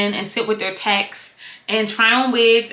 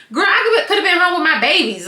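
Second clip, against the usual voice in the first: a hint of Candy, pitched higher and a bit louder; playing Candy; 7 semitones higher, 3 dB louder than usual. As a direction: lightly voice a fed-up mom. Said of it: "if I'm Candy".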